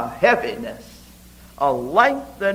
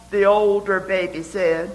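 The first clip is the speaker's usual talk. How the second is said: usual speaking voice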